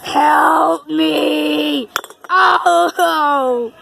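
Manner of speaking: loud pleading cry; cry for help. Pretend. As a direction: yell for help. Calling for help.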